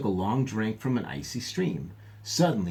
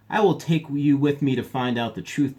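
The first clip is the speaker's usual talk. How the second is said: louder, higher and curt; sharp; 4 semitones higher, 6 dB louder than usual. A narrator's reading. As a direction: cut him off sharply, like an order. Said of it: "the man said sharply".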